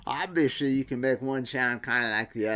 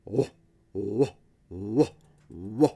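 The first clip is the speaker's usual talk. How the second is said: lower, rounder wah sounds; imitated woofy wah; 3 semitones lower, slower than usual. Shown as a demonstration. Teacher imitates a woofy guitar tone, lower. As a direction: mimic the guitar's woof, lower. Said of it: "when it has woof, it's more of a".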